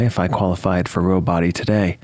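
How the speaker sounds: ordinary talk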